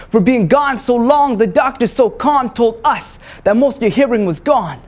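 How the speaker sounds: high, loud, driving declamation; urgent, emphatic spoken word; impassioned slam poetry. Acted. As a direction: perform it at full force for a live audience. Slam poem at full force.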